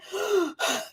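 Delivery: high, breathy gasp for air; sharp gasp. Retold or imitated. Darkness leaves, breathing again.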